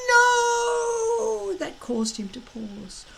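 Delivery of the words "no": high, anguished shout of no; a desperate, helpless yell; powerless cry. A narrator's reading. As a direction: cry it out as a father losing everything. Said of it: "heart-rending, powerless cry of".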